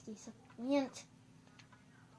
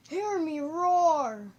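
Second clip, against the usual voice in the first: huge, drawn-out, higher shout; mock battle cry; 5 semitones higher, 14 dB louder, much slower than usual. Gamer mocking own insults, roaring boast. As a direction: roar it out long and loud. Acted boast building to a roar.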